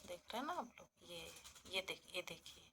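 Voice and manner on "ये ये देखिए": ordinary talk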